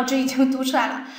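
ordinary delivery

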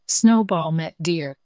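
ordinary delivery

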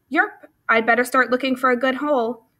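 plain reading voice

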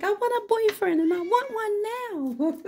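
high mock-whiny bird. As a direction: voice the bird as a spoiled whiner. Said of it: "She's like".

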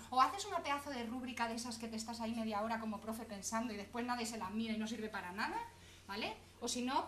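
ordinary talk, nothing marked

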